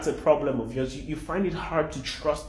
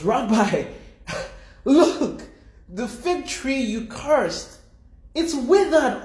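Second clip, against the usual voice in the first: much higher and louder, bursting with amazement; astonished exclaim; 11 semitones higher, 6 dB louder, slower than usual. Acted. Peter pointing out the withered tree to Jesus, astonished. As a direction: cry out like a startled disciple, laughing in disbelief.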